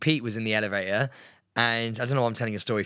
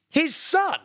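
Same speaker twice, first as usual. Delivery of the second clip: incredulous squeal; 4 dB louder than usual. Real, not acted. Shocked by new presenters news.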